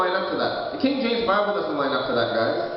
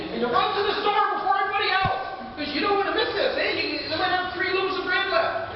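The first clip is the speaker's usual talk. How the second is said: higher, fast-paced telling of the wheelbarrow story; excited storytelling; 9 semitones higher than usual. Retold or imitated. Preacher to viewers, retelling grandfather's inflation story, excited. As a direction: spin the tale with rising excitement.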